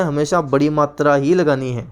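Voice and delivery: usual speaking voice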